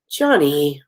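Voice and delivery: usual speaking voice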